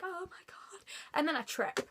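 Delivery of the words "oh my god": high, hushed, whimpering whisper; mock tearful whisper. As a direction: act overwhelmed, all breath and tears. Re-enacting her tearful, overwhelmed reaction.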